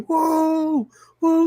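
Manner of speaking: high, drawn-out whoops at ordinary volume; mock scream. Showing how he screamed in interviews, mock scream. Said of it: "he's, like, screaming".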